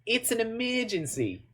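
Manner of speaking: put-on small high-pitched kid voice; cute kid impression. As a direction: imitate an earnest child pleading his case. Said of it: "that kid is so freaking cute".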